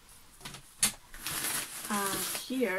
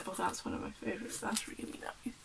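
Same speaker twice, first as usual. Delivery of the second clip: hushed admiring whisper; whispering over a favourite little painting; 9 dB quieter than usual. Real, not acted. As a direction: breathe it to yourself, admiring.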